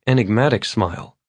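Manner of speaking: ordinary delivery, nothing marked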